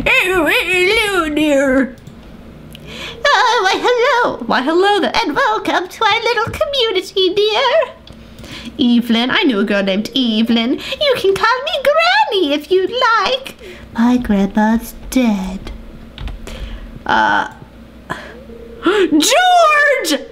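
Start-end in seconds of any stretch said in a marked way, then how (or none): 0.1-7.9 put-on old granny voice. Voicing Granny Evelyn. 10.7-13.6 sweet granny voice. Pretend. Voicing Granny Evelyn. 18.8-20.2 high delighted gasped cry of the name; excited cry. Real, not acted. Gamer spotting George, high excited cry. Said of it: "Oh my gosh".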